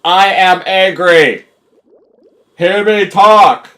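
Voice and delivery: slow, loud, high yell; mock-angry slow holler; yelling into the microphone. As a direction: shout each word for a dim listener. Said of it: "I have to yell".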